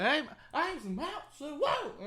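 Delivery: high-pitched imitated tough-guy call; mock street challenger. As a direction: play the aggressor in a high voice. Acting the confronting strangers.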